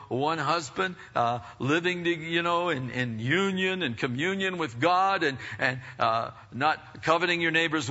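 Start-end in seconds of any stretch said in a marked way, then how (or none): none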